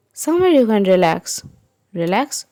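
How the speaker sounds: usual speaking voice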